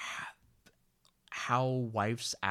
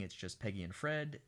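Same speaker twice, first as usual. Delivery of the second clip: usual speaking voice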